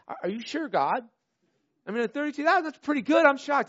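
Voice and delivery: high, pleading disbelief; incredulous Gideon. Retold or imitated. Gideon questioning God, high and incredulous.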